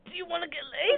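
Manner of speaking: mock caller shout. Prank caller's question retold.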